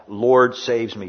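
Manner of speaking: "Lord saves me" said slowly, each word carefully enunciated; careful, drawn-out "Lord saves me"; slow enunciation. Shown as a demonstration. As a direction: spell out every word, unhurried. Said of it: "slowly enunciating each word".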